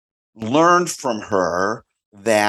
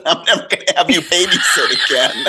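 higher, laughing through it; laughing mock scold; 7 semitones higher, 3 dB louder, faster than usual. Friend refusing babysitter, laughing mock scold. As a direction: tease while laughing. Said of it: "She said".

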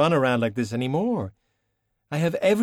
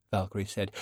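plain reading voice

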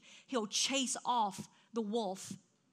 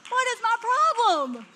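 much louder, higher, self-mocking; incredulous outburst; 9 semitones higher, 8 dB louder than usual. Real, not acted. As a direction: cry out at your own absurdity. Self-mocking exclamation about her anger.

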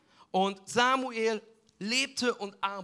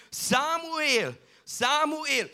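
higher, ringing call of a name; a divine voice calling; 7 semitones higher, much slower than usual. Acted. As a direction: call out from a distance, a bit stronger. God calls a second time.

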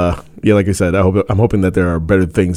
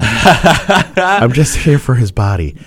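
mock Flair line; 3 semitones higher, much slower than usual. Voicing Flair on a date.

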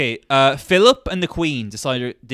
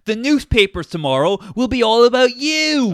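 loud acted line; 8 semitones higher, 5 dB louder than usual. Acting out royal scene.

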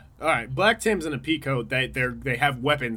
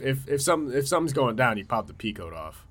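ordinary delivery

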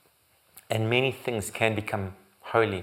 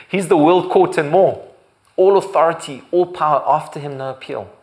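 high, loud, ringing proclamation; thundering sermon, each title punched; preaching at full force; 5 semitones higher, 7 dB louder than usual. Acted. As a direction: preach it out, lifting the crowd. Preaches God's authority.

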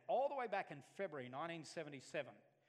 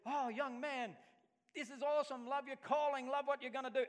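high, loud gushing welcome; a hammed-up pastor heaping praise; imagined praise; 10 semitones higher, 8 dB louder than usual. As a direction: gush like a delighted mentor.

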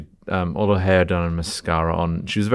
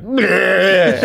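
loud, high-pitched comic puke noise; hammed-up vomiting sound; noisy mock retching; 12 semitones higher, 6 dB louder than usual. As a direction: imitate throwing up, loudly. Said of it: "Really noisily. Throwing up".

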